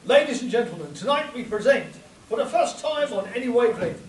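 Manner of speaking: ordinary talk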